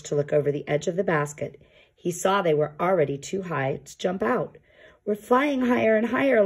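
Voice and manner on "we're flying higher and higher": higher, gleeful; delighted. Acted. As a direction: savour the rising flight. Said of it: "said Yuri".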